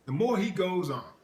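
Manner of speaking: normal talking voice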